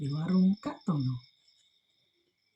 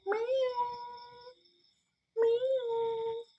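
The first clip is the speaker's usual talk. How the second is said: high squeaky kitten meow; cat meow; 5 dB quieter than usual. Acted. Narrator voicing the trapped cat, high kitten meow. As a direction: mew like a small stuck cat.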